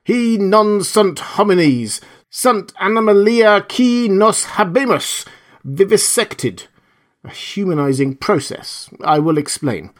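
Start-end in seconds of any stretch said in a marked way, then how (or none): 0.0-6.6 high, slow declaimed Latin; shouted. A narrator's reading. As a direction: proclaim it slowly to someone at a distance. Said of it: "then shouted".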